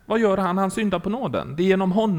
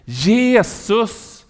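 high, loud, drawn-out call; all-out cry; 5 semitones higher, 5 dB louder than usual. Retold or imitated. A man in hell cries for rescue, loud drawn-out call. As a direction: imitate crying the name with full effort. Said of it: "ropar allt vad han kunde".

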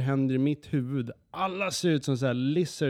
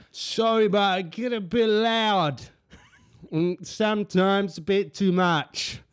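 mock drunk Australian; 6 semitones higher, 5 dB louder, slower than usual. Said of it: "han bara sitter och skriker".

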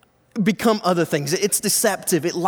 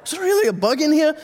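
startled question; 8 semitones higher, faster than usual. Retold or imitated. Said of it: "you're rubbing your eyes like".

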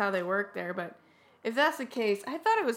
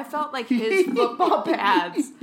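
laughing; 3 semitones higher, 6 dB louder, slower than usual. Real, not acted. Laughing at the movie.

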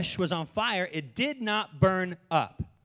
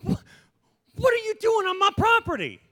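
much higher, startled and bewildered; indignant surprise; 11 semitones higher than usual. Retold or imitated. Pastor confronting a talking bush in his yard, startled. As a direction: confront a trespasser in disbelief. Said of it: "I'd be like".

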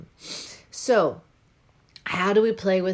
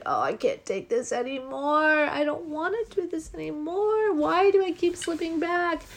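frustrated whine; 9 semitones higher than usual. Retold or imitated. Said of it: "I was like".

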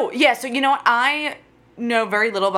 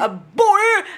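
loud, high-pitched burst; playful exclamation; 12 semitones higher, 5 dB louder than usual. Pretend. Echoing co-host about the swim, playful loud cry.

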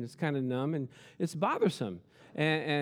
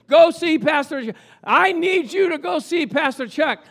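loud, high, insistent command; booming divine command; commanding and demanding; 10 semitones higher, 9 dB louder than usual. Retold or imitated. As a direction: order her to go, pushy and loud. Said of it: "Commanding and demanding".

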